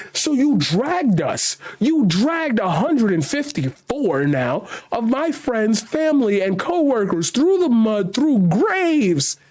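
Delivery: slow, high-pitched accusation; mad. Real, not acted. Host accusing protesters over his dead loved ones, mad. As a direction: hammer each phrase slowly with rising outrage. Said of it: "Now I'm just mad".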